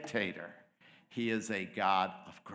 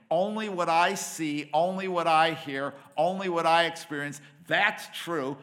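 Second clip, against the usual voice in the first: arrogant inner voice; 6 semitones higher, 6 dB louder than usual. Acted. Voice in your head totalizing reality.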